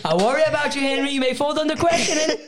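high, put-on film villain voice; movie quote. Quoting a movie to mock Henry, high put-on voice. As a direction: recite it like a film heavy. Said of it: "we can do all the movie quotes".

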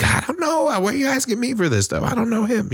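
high-pitched retold protest; mock protest. Kid protesting to mom about being blamed, high mock protest. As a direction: plead innocence in a high mock voice.

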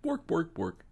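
a hint of a Swedish chef, bork bork; lightly voiced mock Swedish gibberish.

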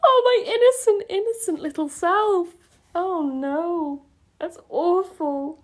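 high, whimpering lament; mortified mock wail. Real, not acted. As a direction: wail in embarrassment, tailing into slow dismay. Mortified at own younger writing.

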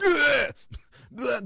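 loud high hammed-up fake vomit noise; fake puking retch. Psychic fakes vomiting at the farm, loud mock retching. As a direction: retch out loud like a bad actor faking sickness. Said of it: "fake puked".

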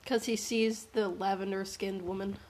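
ordinary delivery

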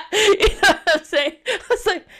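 talking through laughter; words spilling out mid-laugh; laughing as she tells the story. Real, not acted. Laughing while telling.

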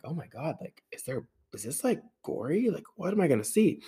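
hushed dread. Retold or imitated. Dreading what she'll find.

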